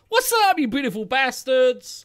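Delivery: loud, high American YouTuber greeting; bad American accent. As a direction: shout it out in an attempted American accent. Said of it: "I can't do an American accent".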